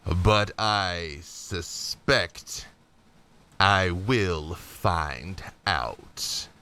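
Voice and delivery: low, very slow threat; menacing villain. Acted. Dark lord to the heroes, slow low menace. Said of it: "turns his head and looks at you and says".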